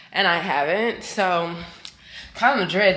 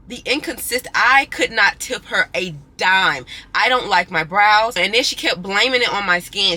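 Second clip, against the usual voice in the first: indignant complaint; real annoyance; 7 dB louder than usual. Real, not acted. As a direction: vent about the bad wax. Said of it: "I'm disgusted".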